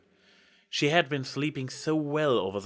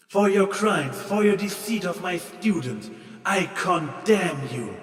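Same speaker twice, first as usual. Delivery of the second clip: loud, higher, slowing sentence of doom; condemning goddess; 7 semitones higher, 4 dB louder than usual. A narrator's reading. Goddess condemning Trixie, loud slow verdict. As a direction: pronounce the sentence with divine wrath. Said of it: "the deity commanded".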